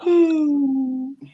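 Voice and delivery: giggly nervous laugh; a jittery little laugh before speaking. Real, not acted. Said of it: "That's my I'm nervous laugh".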